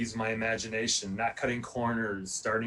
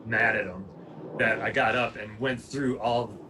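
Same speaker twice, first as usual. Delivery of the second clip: ordinary talk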